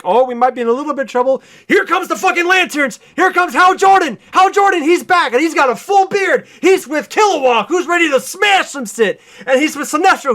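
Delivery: loud, high, fast excited pitch; hyped up. Real, not acted. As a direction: sell it like the crowd is cheering. Hyped narration of hero arrival.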